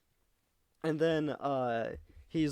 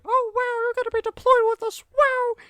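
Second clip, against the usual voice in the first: way higher, louder, fast fangirl squeal; squeaky mock gush; 6 dB louder than usual. Mocking Perez as she gushes to Master Chief, squeaky. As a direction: squeal it like an overexcited fan.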